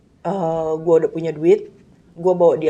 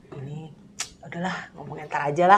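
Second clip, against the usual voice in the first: inner thought; 4 semitones lower, 7 dB quieter than usual. Retold or imitated. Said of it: "Dalam hati gue".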